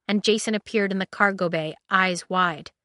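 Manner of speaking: ordinary delivery, nothing marked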